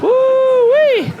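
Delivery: high, loud laughing woo-wee; laughing whoop. Real, not acted. Laughing whoop at the crowd about his bedwetting.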